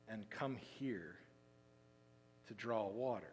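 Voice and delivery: hushed, slowed-down stress for the listeners; slow emphasis. Shown as a demonstration. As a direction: linger on come here to draw water. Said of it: "And don't miss this part".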